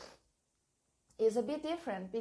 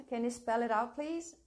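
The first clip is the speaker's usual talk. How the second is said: usual speaking voice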